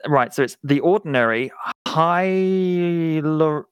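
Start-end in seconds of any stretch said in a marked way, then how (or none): 1.9-3.6 "Hyalur-" drawn out slowly; struggling to pronounce. Real, not acted. Host attempting a hard product name, struggling to say it. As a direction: feel your way through a tricky word. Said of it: "I can't even say this".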